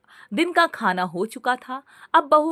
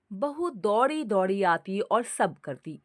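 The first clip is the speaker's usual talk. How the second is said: ordinary delivery, nothing marked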